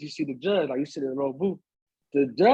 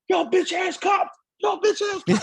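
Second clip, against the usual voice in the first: mock yell; slower than usual. Young boys yelling at cops.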